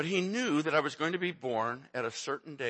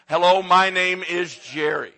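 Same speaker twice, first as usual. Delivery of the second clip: proud greeting; 5 semitones higher, 11 dB louder than usual. Shown as a demonstration. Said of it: "say it that way".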